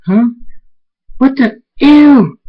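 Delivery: high, loud squeal of disgust; confused waking turning to a loud, high ew; disgusted shock. Acted. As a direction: shriek as you drop something gross. Waking to find gross object.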